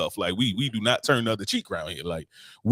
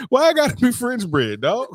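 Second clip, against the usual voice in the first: mock outrage; 12 semitones higher than usual.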